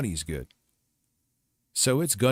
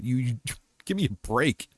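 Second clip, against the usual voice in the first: ordinary talk, nothing marked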